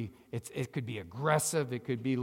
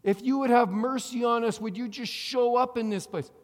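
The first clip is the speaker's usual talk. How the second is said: higher, louder, mildly hammed-up begging prayer; mock pleading prayer; 7 semitones higher, 6 dB louder than usual. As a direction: imitate a desperate, pleading prayer. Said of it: "why do we pray these prayers".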